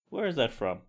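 ordinary talk

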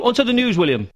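normal talking voice

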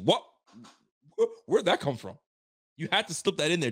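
high, baffled "what?"; surprised. Acted. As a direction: act caught off guard. Mock surprise at pundit.

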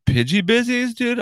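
high, silly sing-song nickname; squeaky nickname. Shown as a demonstration. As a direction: toss off "Pidgey busies" in a tiny squeak. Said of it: "That's what I'm calling".

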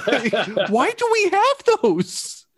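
very high, slow mock-horrified wail; hammed-up henchman squealing in dismay; bewildered stormtrooper. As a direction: wail it in squeaky disbelief, half laughing. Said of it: "it's like the stormtrooper's like".

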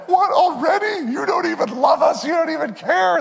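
put-on high, whining child voice; whiny kid protest. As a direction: play the kid outraged about bedtime. Kids whining about bedtime.

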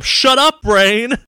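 high yell; arguing with myself. Retold or imitated. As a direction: shout him down, high and loud. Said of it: "Charlie, you don't have Wi-Fi".

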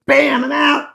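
high, punchy exit line; mock abrupt sign-off. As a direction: punch it out high as you quit. Liver abruptly clocks off, acted.

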